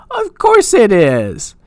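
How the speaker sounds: high, drawn-out mock exasperation; exasperated resignation. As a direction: stretch it out high, as if utterly unsurprised. Her exasperated reaction to plot twist.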